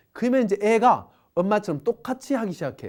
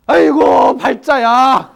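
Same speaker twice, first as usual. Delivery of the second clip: loud, higher wail of frustration; exasperated outburst; 5 semitones higher, 13 dB louder, much slower than usual. Retold or imitated. Wife banging around to get her husband's attention, shouting loud and higher. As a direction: vent it loudly so the husband notices. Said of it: "엄마 속으로 뭐예요".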